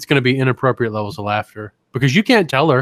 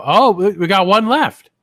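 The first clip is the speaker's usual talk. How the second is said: hint of a jailer; 7 semitones higher, 6 dB louder, slower than usual. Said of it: "the guy's gonna go".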